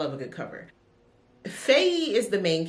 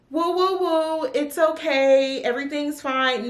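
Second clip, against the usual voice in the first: higher, mock calming "it's okay"; mock soothing; 8 semitones higher, 4 dB louder than usual. To someone who gave her bad news, mock soothing. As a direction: play someone hushing an upset friend. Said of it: "expect me to be like".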